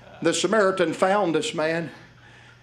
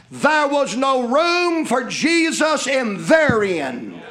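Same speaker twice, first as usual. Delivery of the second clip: preaching invitation; 8 semitones higher, slower than usual. Acted. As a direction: call them in from the pulpit. Preaching at full force.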